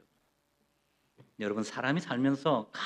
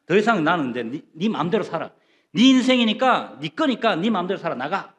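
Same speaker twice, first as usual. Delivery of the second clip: acted exasperation, louder in bursts; mock parental ultimatum; 4 semitones higher, 6 dB louder than usual. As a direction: imitate a parent washing their hands of the kid. Acts parent giving up on child.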